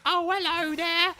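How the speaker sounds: high, slow mock English "hello there"; hammed-up fake English greeting; mock English accent from a tourist at a convention; mock posh English accent. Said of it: "English people don't really sound like that".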